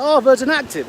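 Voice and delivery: high eager question; excited. Retold or imitated. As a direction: jump on the guess, thrilled. Excited guess about the son.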